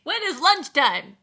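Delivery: high, loud shushing brush-off; a coworker swamped at her desk, mock-whining to be left alone; annoyed busy worker. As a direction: shoo people away from your desk, whiny. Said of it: "and you're like".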